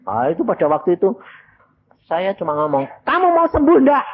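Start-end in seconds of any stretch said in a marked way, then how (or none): 3.0-4.1 suara jauh lebih tinggi dan keras; teriakan menantang. Retold or imitated. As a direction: desak dia dengan teriakan. Preacher challenges sick man. (berteriak menantang si sakit)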